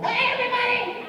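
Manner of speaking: high, eager call to the room; a kid bursting to perform; excited shout. Retold or imitated. Kid grabbing class attention, high eager shout.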